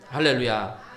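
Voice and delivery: ordinary talk, nothing marked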